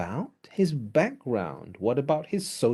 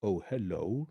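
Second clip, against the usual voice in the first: soft, suddenly lowered greeting; 5 semitones lower, 8 dB quieter than usual. Retold or imitated. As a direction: drop your pitch for a hushed hello. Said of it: "Go lower, go lower".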